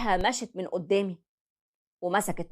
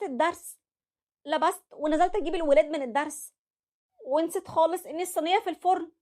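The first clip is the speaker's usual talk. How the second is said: higher, meek wife explaining herself; wife's voice; 9 semitones higher than usual. Acted. Wife excusing herself to her husband, higher meek voice.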